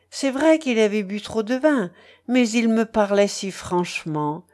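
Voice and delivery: ordinary delivery, nothing marked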